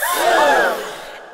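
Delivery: big theatrical gasp; sharp, high intake of horror; hammed-up scandalised gasp; shocked gasp. Shock at the photo retold.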